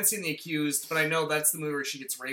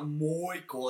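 ordinary talk

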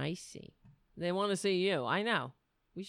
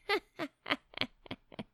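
laugh; 9 semitones higher than usual. Real, not acted. Laughs at herself.

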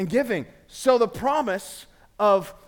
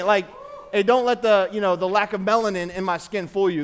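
normal talking voice

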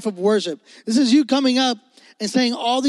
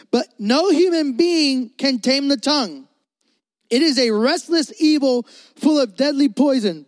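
scripture reading; 5 semitones higher than usual. Acted. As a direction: read the verse with weight. Reads scripture aloud.

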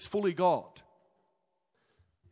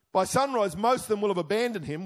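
ordinary talk, nothing marked

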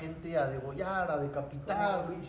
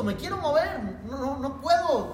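frightened plea; 7 semitones higher, 4 dB louder than usual. Retold or imitated. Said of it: "del miedo me paralicé".

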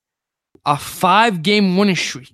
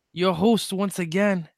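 ordinary talk, nothing marked

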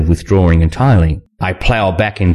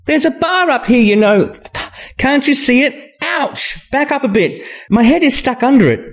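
high, pained complaint; exasperated female character. A narrator's reading. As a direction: complain from a tight spot, pitched far up. Trapped woman complains in pain.

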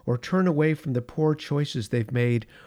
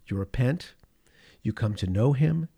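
ordinary delivery

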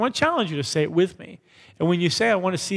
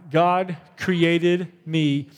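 "God created me on purpose for a purpose" slow, fading at the end; slow recited affirmation; slow unison declaration; much slower than usual. Shown as a demonstration. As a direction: lead the line slowly with the room. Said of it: "Let's say that last line".

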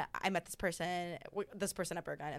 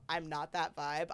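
ordinary delivery

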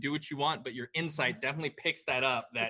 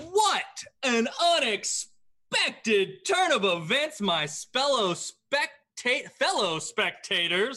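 announcer voice; 8 semitones higher, 5 dB louder, slower than usual. Acted. Announcer addresses arena crowd.

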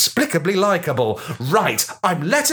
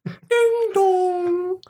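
high sung doorbell chime; doorbell ding dong; 13 semitones higher than usual. Acted. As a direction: sing it like a doorbell. Doorbell sound imitated.

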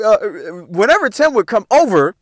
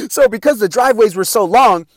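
normal talking voice

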